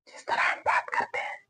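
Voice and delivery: breathy whisper; whispered aside. Shown as a demonstration. Teacher whispering to show the airy ha. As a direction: breathe it out like a soft h. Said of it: "हा".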